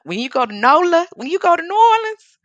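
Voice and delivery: high, loud, mounting hype; real excitement. Real, not acted. Artist hyping New Orleans to her viewers, excited.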